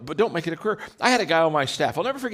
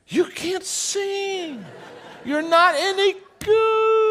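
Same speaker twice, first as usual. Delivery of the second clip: high, very slow, drawn-out mock verdict; a hammed-up friend telling them the truth; mock blunt friend; 4 dB louder, much slower than usual. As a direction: stretch it out like a friend breaking the news.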